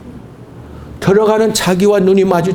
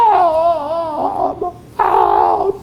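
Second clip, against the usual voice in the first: drawn-out wailing ah; anguished cry; 9 semitones higher, much slower than usual. Retold or imitated. Wife wailing in repentance at church, anguished cry. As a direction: let the cry break out of you.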